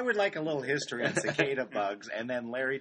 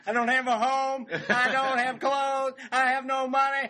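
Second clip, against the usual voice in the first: high, loud comic whine; whiny victim voice; 13 semitones higher, 8 dB louder than usual. Pretend. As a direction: complain like a put-upon local. Mocking flood victims complaining about bugs.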